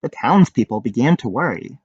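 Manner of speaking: ordinary delivery, nothing marked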